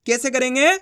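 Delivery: ordinary talk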